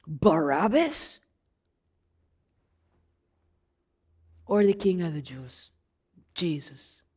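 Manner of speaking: a weary governor coaxing the crowd, fading off; Pilate's voice. Acted. Pilate coaxing the crowd toward Jesus, low and fading.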